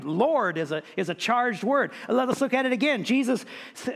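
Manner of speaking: usual speaking voice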